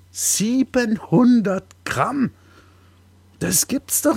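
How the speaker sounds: high, slow disbelief; astonished. Acted. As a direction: draw it out in shock. Mock astonishment at weight.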